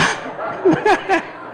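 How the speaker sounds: risa aguda sin voz; risa susurrada. Real, not acted. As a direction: se ríe de su propia historia. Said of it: "risa tras la anécdota". (risita aireada y aguda)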